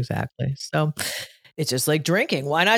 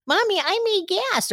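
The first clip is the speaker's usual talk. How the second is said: put-on much higher, sweet small-boy voice; little child voice; 12 semitones higher than usual. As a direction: play her young son confessing. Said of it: "he used to be like".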